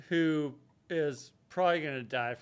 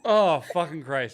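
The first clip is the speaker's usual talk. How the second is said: stifled giggles; 7 dB louder than usual. Real, not acted. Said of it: "I'm trying not to laugh so hard".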